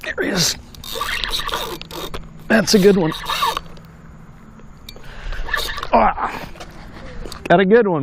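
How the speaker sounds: high, thrilled exclamations; excited. Real, not acted. Angler thrilled as the fish surfaces, high-pitched. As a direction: cheer each glimpse of the fish.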